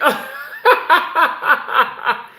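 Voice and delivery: loud, high peal of laughter; delighted laugh. Real, not acted. Host bowled over by a viewer's gift, laughing. Overjoyed at a viewer's kind gesture.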